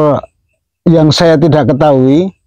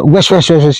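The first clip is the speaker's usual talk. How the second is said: choppy run of "wes" bursts; repeated whooshes. Shown as a demonstration. Explaining which bird is weaker, repeated imitated whooshes. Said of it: "Kalau yang se".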